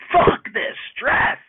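slow, breathy mock yell of frustration; mock rage outburst. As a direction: let it out like you finally snapped, half joking. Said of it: "rage about it".

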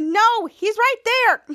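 high, loud, drawn-out retort; urgent pointing out. Retold or imitated. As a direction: call it out like an acted alarm. Retold character points out Brad.

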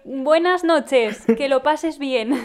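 mock polite farewell. Friend mocks his polite retreat.

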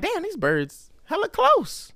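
high, slow, amazed; startled realization; surprised. Retold or imitated. Startled by birds nearby.

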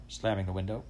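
plain reading voice

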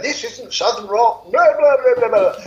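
exaggerated retold objection, ending in loud blah-blah; mock telling-off. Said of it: "he had a real go at me".

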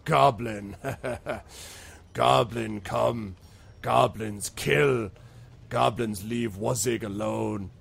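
very slow, ponderous troll with a chuckle early on; simple-minded troll. Acted. Troll explaining goblins to travelers, slow and ponderous. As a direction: lay out each word heavily, one at a time. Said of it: "He says".

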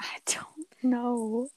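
whisper. Real, not acted. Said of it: "whispered reply".

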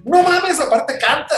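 high, loud mock astonishment; amazed shout. Casting guys react to actor singing, amazed mock shout. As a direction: cry out in disbelief, voice shooting up.

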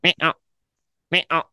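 high, loud meow to call the cats. Shown as a demonstration. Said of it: "I'm doing it to both the cats".

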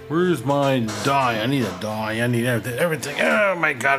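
usual speaking voice

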